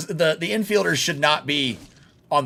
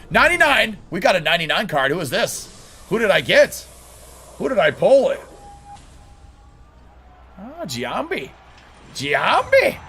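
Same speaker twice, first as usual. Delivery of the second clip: pack-pull excitement; 6 semitones higher, 4 dB louder than usual. Real, not acted. Pack opening, pulled rare card.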